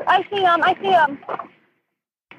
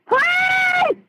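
very high panicked screams; screaming; 13 semitones higher than usual. Real, not acted. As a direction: scream for help. Screaming as car fills.

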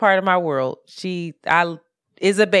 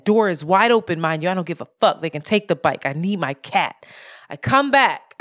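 ordinary talk

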